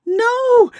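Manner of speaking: high, drawn-out cry of alarm. A narrator's reading. Mama stopping her daughter at the door, crying out. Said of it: "her mama cried".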